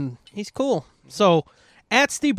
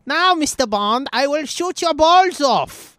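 put-on high, slow movie villain accent; Bond villain voice; 8 semitones higher, 4 dB louder, slower than usual. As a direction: play a menacing movie villain. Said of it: "He talks more like a Bond villain".

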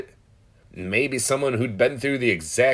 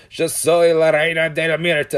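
high, loud drunken-night yell, acted out; re-enacted rowdy holler; retold yelling; 7 semitones higher, 6 dB louder than usual. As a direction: yell it in Spanish like a proclamation. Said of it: "I just started yelling".

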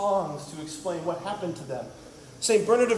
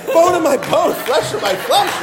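higher, louder, grandly declaimed poetry; mock Shakespearean; 6 semitones higher, 5 dB louder than usual. Adam declaiming to Eve like a lightly hammed-up Shakespeare. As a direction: ham it up like a smitten poet. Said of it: "he suddenly becomes Bill freaking Shakespeare".

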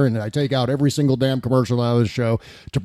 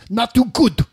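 higher-pitched mock politician, affected "good"; Donald Trump; 7 semitones higher than usual. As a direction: parody the verdict, popping the hard G and D. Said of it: "he's got an affectation".

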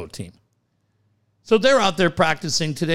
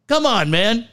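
high-pitched exasperated plea; retold scolding; 8 semitones higher than usual. Dad to son about teasing, retold scolding. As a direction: plead with him, pitched up.

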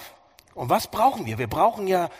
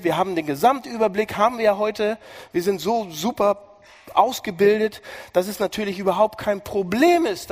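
loud, higher mock boasting; sarcastic; 4 semitones higher, 4 dB louder than usual. Preacher mocking know-it-all modern people, sarcastic and loud. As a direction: lay the sarcasm on thick, smug and loud. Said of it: "Das war Sarkasmus".

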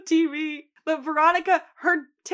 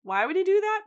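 puzzled question; 8 semitones higher than usual. Retold or imitated. Retold puzzled question about Frank.

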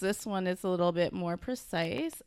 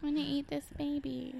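put-on gushing baby lover; 6 semitones higher, 7 dB quieter than usual. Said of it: "people always like".

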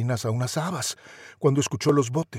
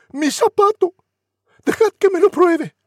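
very high, loud demanding; frantic grasping sister; shrieking stepsister; 8 dB louder than usual. Acted. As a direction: shriek to try the shoe. Other stepsister shouts.

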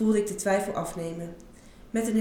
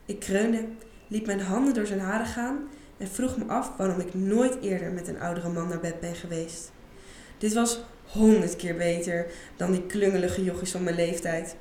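ordinary delivery, nothing marked

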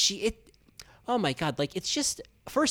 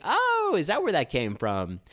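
surprised realization; 8 semitones higher than usual. Retold or imitated. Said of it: "so I was like".

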